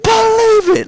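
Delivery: very high, loud shouted catchphrase; Naruto catchphrase. Pretend. Gamer riffing Naruto as Ruto insists, loud high shout. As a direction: yell it like an anime hero. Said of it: "Like it's an episode of Naruto".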